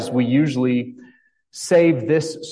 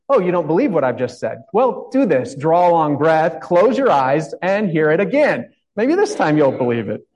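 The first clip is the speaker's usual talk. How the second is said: paraphrased Queen; 4 semitones higher, 4 dB louder than usual. Retold or imitated. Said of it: "paraphrasing the Queen".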